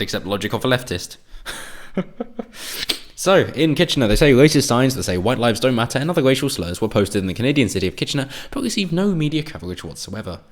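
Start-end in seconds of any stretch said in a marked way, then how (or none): 1.2-3.1 airy high-pitched derisive laugh; high mocking laugh. Real, not acted. Scoffing at leftist logic, high airy laugh. 3.2-10.4 louder, higher reading-out voice; news read. Acted. Reading a news story to viewers, louder news-read tone.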